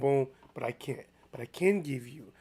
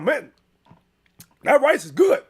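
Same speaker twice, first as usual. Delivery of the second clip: loud mock relish; 8 semitones higher, 8 dB louder than usual. Relatives at blind date dinner.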